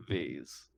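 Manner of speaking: "vase" low and soft, as "vayz"; "vase" rhyming with "phase"; pronounced "vayz". Real, not acted. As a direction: say "vase" with a long ay. Group trades pronunciations of vase.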